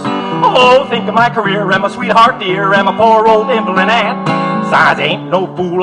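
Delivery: ordinary delivery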